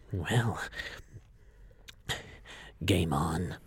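winded, with long breathy pauses; acted heavy breathing, low on the last words; breathing heavily. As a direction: pant between the words, aghast. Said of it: "He's breathing heavily and says".

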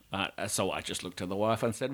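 normal talking voice

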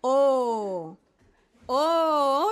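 loud round O; pupil repeating O. Acted. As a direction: copy the O eagerly. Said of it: "La O se forma".

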